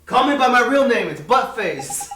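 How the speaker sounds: put-on a stubborn kid yelling his chosen name; loud indignant protest. Kid yelling at the fairy to use his name, loud protest. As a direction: shout the name out, stretching Buttface.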